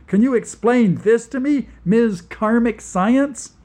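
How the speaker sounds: high, loud sarcastic jab; sarcastic demand. Acted. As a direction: needle her with scorn, loud and high. Angelo snapping sarcastically at her.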